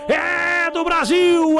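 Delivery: long held roar on Brasil; shouted goal cry. Acted. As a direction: stretch out the shout.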